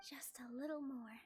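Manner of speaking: breathy whisper to herself; whispered thought. Acted. Nilou steadies herself during the debate, whispering. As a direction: barely voice it, as a private thought.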